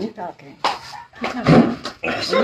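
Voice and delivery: whisper. Acted. Teasing partner, whispered.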